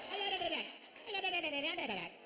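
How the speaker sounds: "edited it" hoch und stolpernd als did-it-its; tangled edited it. Retold or imitated. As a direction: trip over "edited it" in a high voice. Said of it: "marbles cascading down a hardwood staircase".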